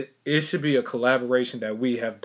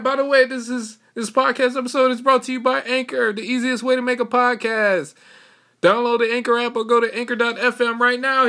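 high, loud upbeat sponsor read; 12 semitones higher, 7 dB louder than usual. Acted. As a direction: pitch it like a cheery radio ad. Reads sponsor message.

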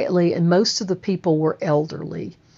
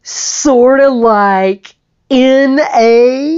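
high, loud and slow, delighted; joyful slow mock surprise; amazed echo; 5 semitones higher, 9 dB louder, much slower than usual. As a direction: stretch the words in disbelief. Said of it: "And he goes".

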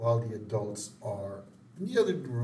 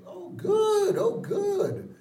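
very high, loud mock-Marge relief; relieved; 6 dB louder, much slower than usual. Mother told guests are hours away, hammed-up relief. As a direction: gush relief way up in pitch. Marge learns there is time.

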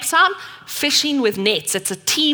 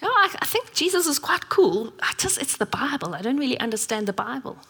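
a hint of a curious friend, higher and hushed; 5 semitones higher, 4 dB quieter than usual. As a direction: lightly voice the friend, half whispering his doubts. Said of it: "And he goes".

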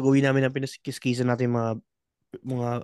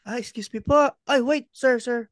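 polite robber; 6 semitones higher, slower than usual. Retold or imitated. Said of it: "Pasok yung hold upper ng magalang".

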